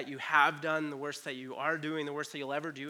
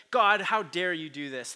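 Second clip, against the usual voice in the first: defiant challenge to God; 4 semitones higher, 8 dB louder than usual. Retold or imitated. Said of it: "if we question God and be like".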